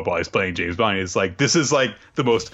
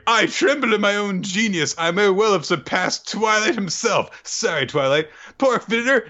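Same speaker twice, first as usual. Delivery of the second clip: pitched way up, pompous boast; high smug bragging spy; 11 semitones higher than usual. Retold or imitated. Recapping the spy's boastful monologue, high and pompous. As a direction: gloat grandly, high.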